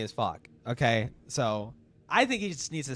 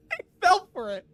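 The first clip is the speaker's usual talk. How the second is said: laughing; 4 dB louder than usual. Real, not acted. Laughing at clip.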